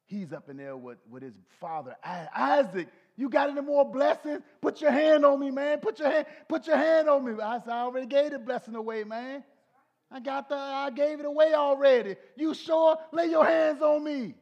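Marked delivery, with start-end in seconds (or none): 2.0-7.4 loud pleading son, pitched up high; loud high pleading plea; begging Esau. Retold or imitated. Esau begging father for blessing. 7.7-14.3 loud, high acted exchange; hammed-up begging back and forth; mock Isaac and Esau.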